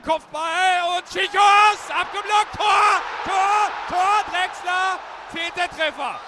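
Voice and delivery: yelling the goal call; roaring Tor over the crowd; screaming goal commentary. Real, not acted. Radio goal call, first goal.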